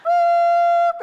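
long, very high, loud toot; imitated steam whistle. Preacher imitates steam blowing from ears, shrill whistle. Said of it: "the steam blows out of their ears".

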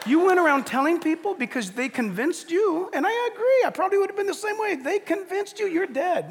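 hammed-up telling off; mock scolding. Pastor teasing the evangelising patient, loud mock scolding.

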